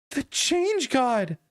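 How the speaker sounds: slow, high grand reveal; dramatic reveal. Acted. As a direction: announce the god's name slowly. Character reveals identity.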